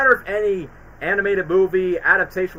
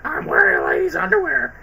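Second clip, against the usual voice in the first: higher, cartoonish mock voice; quoting Shrek; 9 semitones higher than usual. Quoting the underwear gag in a high put-on voice. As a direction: squeak it like an embarrassed character. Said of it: "the lady's underwear".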